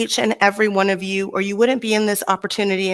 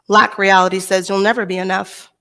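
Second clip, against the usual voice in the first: normal talking voice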